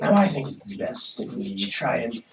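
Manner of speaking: normal talking voice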